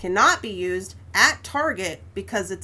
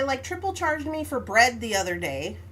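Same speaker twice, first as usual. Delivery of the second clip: ordinary talk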